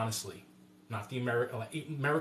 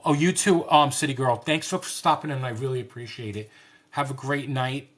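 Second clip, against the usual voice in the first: excited goodbye; 3 semitones higher, 12 dB louder than usual. Real, not acted. Host thanking City Girl for stopping by, excited and loud. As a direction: call it out warmly as she leaves. Loudly thanking departing viewer.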